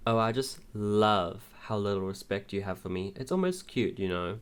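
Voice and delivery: usual speaking voice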